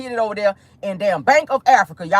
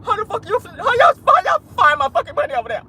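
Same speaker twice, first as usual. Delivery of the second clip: high, stammering mock distress; mock hurt; 11 semitones higher than usual. Host imitating Tasha K live, mock hurt. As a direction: imitate someone upset, tripping over the words. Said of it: "She was hurt".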